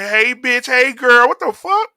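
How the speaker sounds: high, sing-song mock voice; mocking effeminate men. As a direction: camp it up to ridicule them. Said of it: "I see these fucking men walking around".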